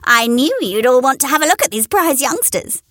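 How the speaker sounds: high proud hen; high boasting hen. A narrator's reading. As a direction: boast to the company. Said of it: "she said to the rest".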